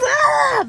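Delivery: high mock cry. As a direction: squeal like the video.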